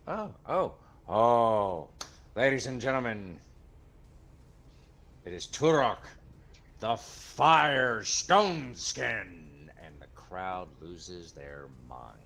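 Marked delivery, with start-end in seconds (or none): 0.1-9.7 louder, higher, stretching the new title very slowly; hyped arena announcer. Acted. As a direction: unveil the fighter's new name to the arena. Announcer reveals fire breath.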